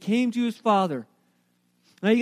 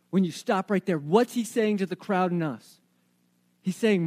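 normal talking voice

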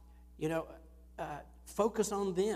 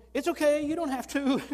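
higher and louder, rushing to reassure; eager reassurance; 10 semitones higher, 10 dB louder than usual. Retold or imitated. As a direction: hurry to comfort her, voice lifted. Retelling reassuring the crying visitor.

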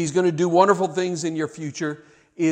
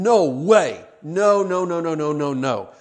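emphatic denial; 3 dB louder than usual. Retold or imitated. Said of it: "it's like Paul saying".

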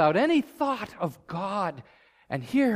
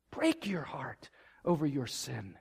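whispered plea; 5 semitones lower, 9 dB quieter than usual. Real, not acted. Preacher repeating his plea to the congregation, whispered. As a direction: whisper it, hushed and intimate.

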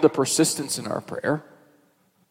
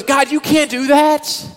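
much higher, slow, pleading protest; alarmed Moses; 13 semitones higher, 4 dB louder, slower than usual. Retold or imitated. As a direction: beg God to stop. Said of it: "And Moses goes".

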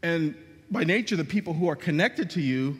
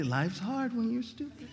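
faintly; 5 semitones higher, 6 dB quieter than usual. Retold or imitated. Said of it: "You'll hear Eli say faintly".

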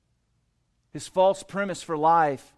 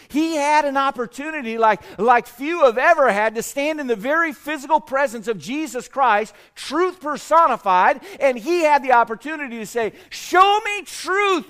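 preaching at full force; 8 semitones higher, 7 dB louder than usual. Acted. Preacher urges the congregation about Pilate's missed chance, loud and high. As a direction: preach it, rising and pressing harder. Preacher builds to emphatic peak.

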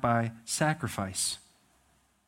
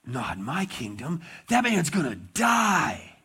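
enraged king; 3 semitones higher than usual. Retold or imitated. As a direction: build to outrage at the rich man. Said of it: "David is filled with rage".

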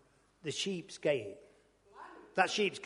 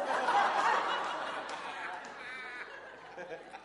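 long airy laugh; breathy laugh; 8 semitones higher than usual. Real, not acted. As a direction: let the laugh roll out. Laughing at own story.

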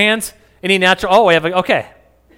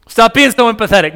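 higher, louder hammed-up scold; mock scolding wife; 6 semitones higher, 6 dB louder than usual. Wife scolding him for feelings, mock high scold. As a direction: nag him, high and loud. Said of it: "Lydia's like".

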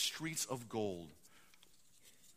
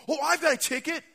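high, loud excited claim; eager announcement; 13 semitones higher, 10 dB louder than usual. Acted. Packers fan to the angel at the gate, loud and eager. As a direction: announce it proudly at high pitch.

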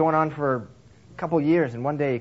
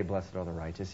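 low, much softer misquote; 7 semitones lower, 11 dB quieter than usual. Shown as a demonstration. Preacher softly giving the wrong quote of Jesus. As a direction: drop it low and quiet as the wrong version. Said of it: "Jesus didn't say".

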